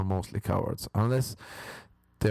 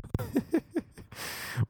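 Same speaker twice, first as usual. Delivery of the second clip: breathy laugh; 3 dB quieter than usual. Real, not acted.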